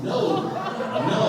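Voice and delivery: high, loud, laughing refusal; emphatic refusal. Retold or imitated. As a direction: cry out a hammed-up no. Acted refusal of friend's offer.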